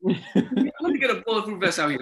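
hammed-up desperate request; mock pleading demand. RA stuck out with armed police, mock pleading. Retells wanting protection like police.

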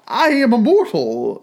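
put-on remonstrating old Scrooge. A narrator's reading. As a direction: plead your case with the spirit. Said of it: "Scrooge remonstrated".

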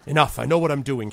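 a touch of an angry leader; sharp rebuke. Acted. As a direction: cut her off firmly. Villain snaps at companion.

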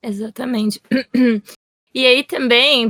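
normal talking voice

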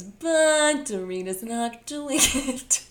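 slow words giving way to laughter; laughing. Real, not acted. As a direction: laugh through the drawn-out line. Laughing while criticizing Dorit.

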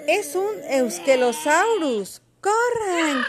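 loud, high warning cry; alarmed shout. A narrator's reading. Pili and Milo warn everyone of the beast, shouting. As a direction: sound the alarm, rising to a yell. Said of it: "gritaron Pili y Milo".

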